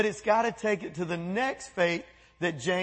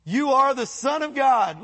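higher, louder cry of the demons; demons shouting; 5 semitones higher, 5 dB louder than usual. Retold or imitated. Demons crying out to Jesus, louder shout. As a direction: shout it like a possessed crowd.

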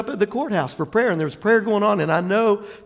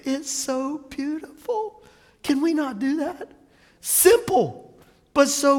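high, soft, slow mock-tearful voice; hushed, drawn-out awe; tearful awe, imitated; 6 semitones higher, 3 dB quieter than usual. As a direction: softly marvel as if moved to tears. Echoes the tearful viewers' awe.

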